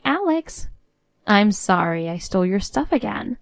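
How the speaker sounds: ordinary talk, nothing marked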